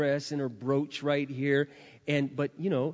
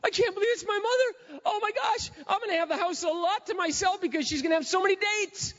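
much higher, gushing, half-crying; mock tearful son; 13 semitones higher than usual. Son seeing his made-over mother, high tearful gush. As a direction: play the stunned teenage son. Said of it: "is just sobbing".

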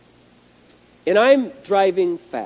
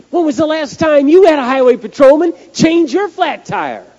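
high, loud incredulous challenge; loud disbelief; 8 semitones higher, 7 dB louder than usual. Real, not acted. Preacher asking listeners about a patrolman changing a tire, loudly incredulous. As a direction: throw it at the crowd in amazement.

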